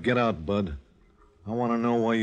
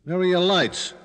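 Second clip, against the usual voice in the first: loud; 8 semitones higher, 7 dB louder than usual. Acted. Thug switches lights on angrily.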